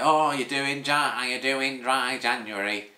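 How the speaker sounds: an odd, slowed voice for the barber, lightly done; put-on barber voice. Barber asking the speaker about dry January, mock put-on voice. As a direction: mimic the barber with a silly unplanned voice. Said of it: "he doesn't talk like that".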